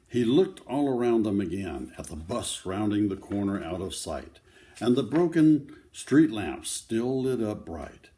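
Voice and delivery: lively picture-book read-aloud; reading to children. Acted. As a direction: tell the story to a circle of kids.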